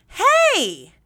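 high and loud overdone hey; pretending to know the stranger, she greets him with loud gusto; fake enthusiastic greeting. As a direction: throw your arms open and fake delight. Feigning joy at the stranger.

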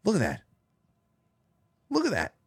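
amazed. Real, not acted. Amazed at hoof video.

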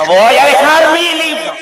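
high loud pop-singer impression, laughing; [name]. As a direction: belt it like a pop star. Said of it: "Antes hacía [name]".

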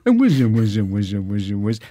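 repeated mock Bowie "whiz" sounds; a light Bowie imitation, fading away; Bowie noise. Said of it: "This is [name] writing a letter to his mum".

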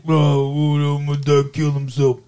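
slurred, Muppet-like mock wail; mock sobbing son. Son grieving his dad's death, hammed-up mock blubbering. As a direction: blubber it in a put-on cartoon voice. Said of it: "is all like".

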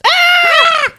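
high-pitched scream of fright. Acted. Reader reveals she is a ghost, high mock scream. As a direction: shriek up high, briefly.

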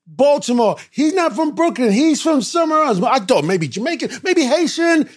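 high loud rapid bragging; excited shout. Retold or imitated. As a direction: boast about calling it right. We had a bet.

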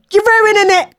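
high, squealed complaint; hammed-up high protest; mock whining. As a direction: whine it playfully, pitched right up. Mock squabble with friend.

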